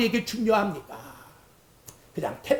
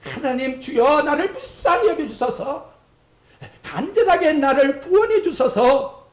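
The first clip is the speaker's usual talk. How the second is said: higher, pleading cry to God; desperate plea; 5 semitones higher than usual. Shown as a demonstration. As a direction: beg as if drowning. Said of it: "마지막 판에". (절박하게 부르짖는 기도)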